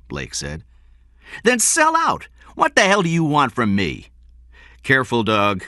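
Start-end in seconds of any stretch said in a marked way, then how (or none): 1.4-4.1 high, loud exasperated outburst; angry retort. A narrator's reading. King snaps back in the quarrel. 4.8-5.6 higher, abrupt caution; sudden warning. A narrator's reading. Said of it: "Benjamin said suddenly".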